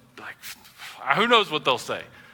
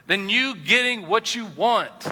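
slow emphasis; 4 semitones higher, slower than usual. Acted. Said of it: "stresses point slowly".

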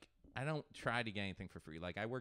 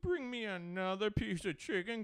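high, loud caricature of a fussy diner; mocking picky eater; 8 semitones higher, 4 dB louder, slower than usual. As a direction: mimic the fussy eater in a raised voice. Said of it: "that's when I start in".

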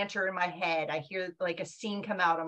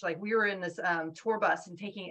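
ordinary talk, nothing marked

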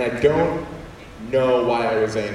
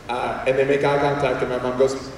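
usual speaking voice

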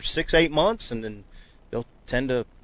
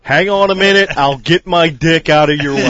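joking loudly; 4 semitones higher, 9 dB louder than usual. Real, not acted.

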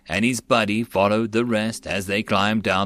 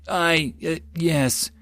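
halting, higher-pitched reply; unsure, broken-up answer; hesitant; 6 semitones higher, much slower than usual. A narrator's reading. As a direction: falter before agreeing. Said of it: "Jutsu replied hesitantly".

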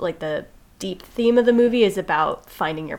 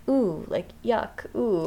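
prissy disgust; 3 semitones higher, 4 dB quieter, slower than usual. Retold or imitated. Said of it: "she's also kind of like".

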